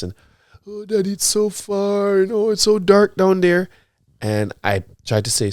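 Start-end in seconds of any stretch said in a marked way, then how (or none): 0.7-3.7 high-pitched imitation of a whining daughter; whiny child. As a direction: plead like a reluctant little kid, mimicked. Father voices reluctant daughter's excuse.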